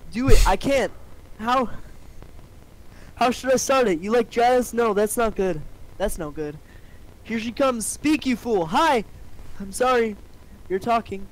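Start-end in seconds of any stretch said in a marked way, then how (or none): 0.1-0.4 loud push to himself; self-urging. Acted. Barry daring himself to talk to the human, loud self-urging. 0.5-10.2 higher, jittery little bee talking himself into it; a nervous bee psyching himself up. Acted. Barry dithering over whether to talk to Vanessa, higher nervous voice.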